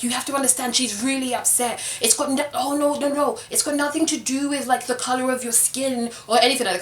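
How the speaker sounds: patronising reassurance, a touch high; mock excusing voice. Castmates defending the accuser to Rio, mock patronising.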